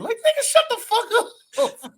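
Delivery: high laughing brush-off; laughing retort. Real, not acted. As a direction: crack up while telling them off. Laughing dismissal of store clerks.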